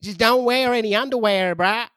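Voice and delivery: cheeky prank caller voice; mock caller giving instructions; crude caller. Caller character continues.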